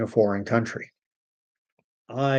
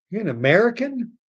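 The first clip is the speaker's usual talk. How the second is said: mock disbelief; 7 semitones higher than usual. Mock incredulous retort.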